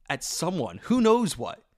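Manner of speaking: usual speaking voice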